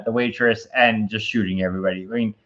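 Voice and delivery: ordinary talk, nothing marked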